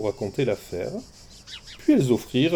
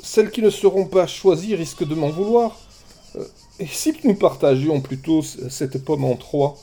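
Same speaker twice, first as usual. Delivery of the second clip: sly suggestion; 6 semitones higher, 4 dB louder than usual. Acted. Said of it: "Malin, il ajouta".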